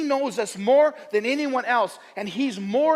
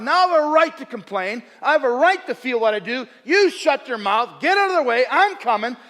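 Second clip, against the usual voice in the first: loud, high, acted defiance; defiant self-justification; 5 semitones higher, 6 dB louder than usual. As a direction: push back forcefully, demanding your right. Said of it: "And so I thought".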